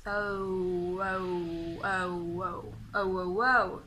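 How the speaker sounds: repeated long gliding OH, held low and even; OH sound drill. Shown as a demonstration. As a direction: draw out each OH, closing to OO. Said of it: "I want you to make that sound".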